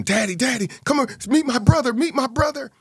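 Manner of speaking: put-on high, eager child's call; high excited childlike voice; excited little girl. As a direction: pull daddy over like a thrilled little girl. Little sister excited to introduce.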